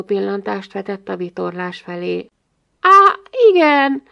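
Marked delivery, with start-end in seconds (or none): none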